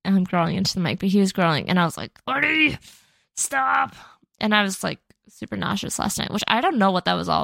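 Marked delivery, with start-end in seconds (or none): 2.3-3.9 high-pitched call to a dog; retold dog scolding. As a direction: imitate calling off a growling dog.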